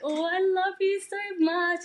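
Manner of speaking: high, loud adoring coo; swelling, high-pitched outburst of affection; proud daddy gushing. Acted. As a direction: gush like a doting parent over a little kid. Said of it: "your daddy, when he's really proud".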